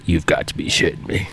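low, under-the-breath amazement; a stunned old-timer, half to himself; muttered disbelief. Retold or imitated. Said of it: "And he was just like".

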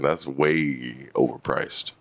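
slow, drawn out. Real, not acted.

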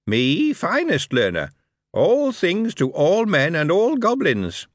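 high goblin voice, slow and deliberate; measured goblin reproach, each question weighed; careful goblin. A narrator's reading. Goblin carefully rebuking Moist, high goblin voice. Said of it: "and said carefully".

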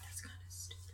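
a quiet whispered remark; softly judging whisper; whispered aside. Real, not acted. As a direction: mutter it under your breath.

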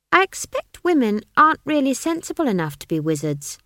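put-on high child voice with a sharp edge; pointed, high-pitched sarcasm. A narrator's reading. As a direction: needle him as an indignant child. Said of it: "the fresh edge to Esk's tone".

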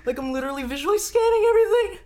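high, fast squeaky Shaggy voice. Retold or imitated. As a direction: rattle it off like panicked Shaggy. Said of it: "Oh my God".

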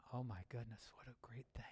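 hushed breathy whisper of wonder; awed whisper. Real, not acted. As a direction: whisper it as if overwhelmed by awe. Awed aside after hymn line.

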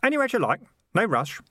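high-pitched helpful reassurance; innocently obliging, in a high voice. A narrator's reading. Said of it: "said Keith helpfully".

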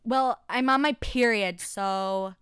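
a hint of a snotty teen; mocking entitled girl. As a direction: imitate a know-it-all classmate. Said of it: "they'd be like".

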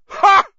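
loud, very high scornful ha; yelled scoffing laugh. Acted. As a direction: bark a triumphant ha. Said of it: "yelled Sir Patrick's head".

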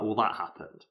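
dry, deadpan sarcasm; sarcastic. Retold or imitated. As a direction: say it like it's obviously nothing. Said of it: "Like, the sarcastic way".

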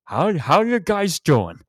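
put-on high, loud, overjoyed old man impersonation; mock-cheery elderly star. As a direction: greet them with hammed-up delight, drawn out slowly. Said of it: "he's so happy to see him".

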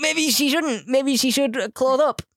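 high, far put-on prim voice. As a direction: pitch it up like a fussy prude. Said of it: "And I'm like".

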